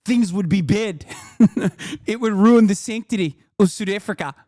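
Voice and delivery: exaggerated Afrikaner accent, laughter breaking in; South African accent. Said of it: "Was that a good South African accent".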